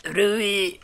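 high, ghostly call of a name; a lightly voiced spooky summons; eerie calling voice. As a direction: imitate the door calling out. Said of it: "It's calling my name. It's like".

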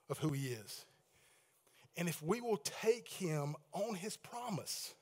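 normal talking voice

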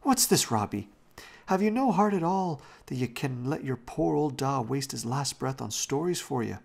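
high, loud reproach easing slightly; scandalized scolding. A narrator's reading. Janet rebuking Robbie over Da's last breath, scandalized. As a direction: scold indignantly, starting at a high pitch. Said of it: "exclaimed Janet with a scandalized look".

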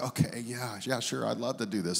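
low, soft, reluctant yes; mock-willing, subdued acceptance; meek agreement. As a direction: give in quietly, as if under orders. Gives in to wife's look, feigned eagerness.

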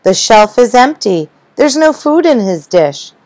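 alarmed cry. Acted. Wife finds the fish shelf empty, alarmed high cry. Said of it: "Then Valerie cried".

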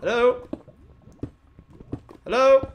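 high, loud questioning call. Pretend. Gamer searching for the noisy creature, calling out. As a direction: holler to see who is there.

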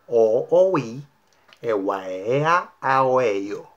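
"O, o, ui" as connected vowels, mouth shaping each one fully; vowels only, exaggerated. Shown as a demonstration. Teacher demonstrates a modulation drill, "a, a, o" in exaggerated vowels. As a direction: drop all consonants, stretch "e, ua, e" with the phrase's accents. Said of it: "modular exageradamente cada vocal".

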